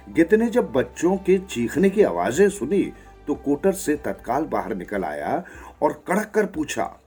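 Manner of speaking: usual speaking voice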